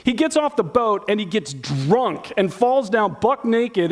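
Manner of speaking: ordinary delivery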